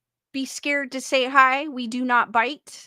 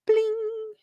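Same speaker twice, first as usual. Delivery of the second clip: high, sustained chime; lightbulb-moment ding sound; mock lightbulb bling; 8 semitones higher than usual. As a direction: ring out a high little bling. Imitates lightbulb switching on.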